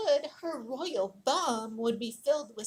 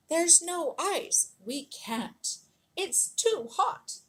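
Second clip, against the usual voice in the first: higher and slower, mildly pleading builders; a touch of worried workmen saying it can't be done; builders' protest; 6 semitones higher, 3 dB louder, slower than usual. Acted. Builders telling Catherine there is no ice, mild pleading protest.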